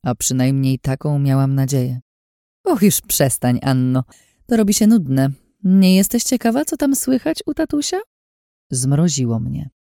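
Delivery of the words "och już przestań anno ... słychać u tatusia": higher, smug, teasing voice; sly Lin, taunting. A narrator's reading. Lin teasing Anna she has seen through her disguise, sly and smug. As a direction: purr it out like a scheming woman. Lin's voice in narration.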